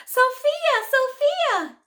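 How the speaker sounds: put-on very high, loud child calling out a name; girl yelling. A narrator's reading. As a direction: shout for your friend across the schoolyard. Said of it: "Jenny yelled out".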